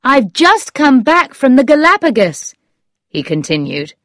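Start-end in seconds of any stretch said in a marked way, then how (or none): 0.0-2.5 high, louder, animated recollection; animated storybook exclamation; exclaiming. A narrator's reading. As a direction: keep crying out as it comes back. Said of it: "he cried".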